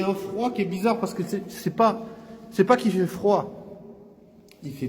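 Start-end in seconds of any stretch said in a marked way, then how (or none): none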